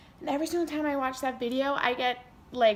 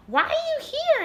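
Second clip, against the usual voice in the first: high, louder mock surprise; surprised teacher; 6 dB louder than usual. Dance teacher sees injured student arrive, high mock surprise. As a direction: act the teacher's startled question.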